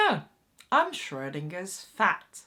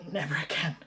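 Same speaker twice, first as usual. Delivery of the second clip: whispered aside; 3 semitones lower, 4 dB quieter, faster than usual. Real, not acted. Whispered aside after the nightmare.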